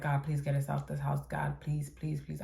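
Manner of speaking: soft, low pleading under the breath; retold praying. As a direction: plead quietly to God. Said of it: "praying the whole time".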